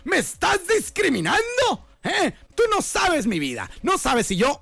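high, hammed-up outraged challenge; mock indignation. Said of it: "te pones picuda y le dices".

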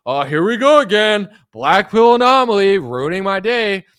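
mock annoyed fans. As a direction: whine like annoyed followers. Mocking fans again.

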